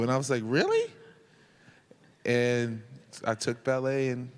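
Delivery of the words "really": high squeaky disbelief; incredulous. Retold or imitated. Told to take ballet, high incredulous really. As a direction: shoot up in pitch, doubting.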